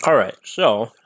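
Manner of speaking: talking around ice; words muffled by ice cubes; ice in mouth. Real, not acted. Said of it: "got ice in my mouth".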